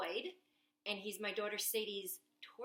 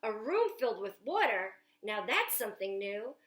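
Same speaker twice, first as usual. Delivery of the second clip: put-on lively picture book read aloud to children; storytime reading; 4 dB louder than usual. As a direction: read it like story time at the library, bright and eager. Reads Jack's lines aloud.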